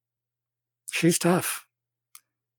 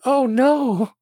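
a demure little cry, higher and louder; mock-demure "Oh, no!" from a young apprentice; demure gasp; 9 semitones higher, 5 dB louder than usual. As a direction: play the innocent girl caught out.

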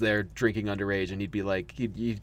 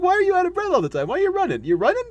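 high, fast, laughing questions; laughing mock questioning; 5 dB louder, faster than usual. A fan teasing the Doctor about always being out of breath, high fast laughing. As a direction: squeal the questions through laughter.

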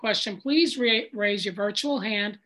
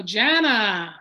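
louder name call, drawn out; 6 dB louder than usual. Real, not acted. Host calling Jana to share, calling out.

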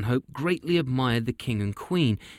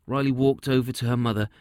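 ordinary delivery

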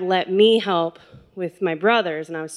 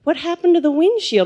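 high, louder mock-shocked question; astonished mom; 8 semitones higher, 5 dB louder than usual. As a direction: imitate mom blurting out her surprise. Clueless mom asks about broken windshield.

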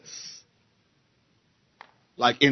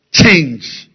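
"change" hit loud with a falling end; stress on change; 2 semitones higher, 10 dB louder than usual. Shown as a demonstration. Preacher emphasizing the key word, "change" punched. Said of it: "emphasizing the word".

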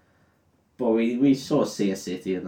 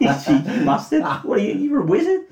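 much higher, fast, cheeky jeer; hammed-up outraged teasing; mock indignation; 10 semitones higher, 4 dB louder, faster than usual. Frodo mocks Gandalf's trick.

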